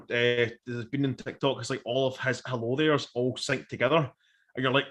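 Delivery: ordinary talk, nothing marked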